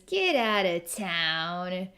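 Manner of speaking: slow, drawn-out teasing brush-off; giggly playful dismissal. Retold or imitated. Forest Princess teasing someone who calls himself a freak, playful dismissal. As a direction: stretch it out in playful disbelief. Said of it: "she kinda giggles and says".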